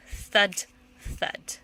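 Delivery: usual speaking voice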